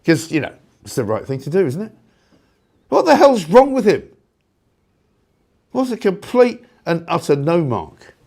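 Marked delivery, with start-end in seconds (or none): 2.9-8.1 much higher, louder cry of disbelief; host boiling over about the Prime Minister; exasperated outburst. Real, not acted. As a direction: throw it out in disbelief.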